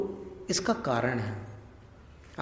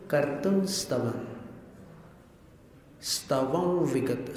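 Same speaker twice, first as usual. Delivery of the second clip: usual speaking voice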